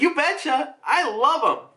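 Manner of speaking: tag. Retold or imitated. Dad cheers up daughter.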